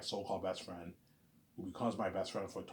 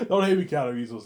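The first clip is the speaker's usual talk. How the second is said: laughing; 5 semitones higher, 13 dB louder than usual. Real, not acted. Joking apology.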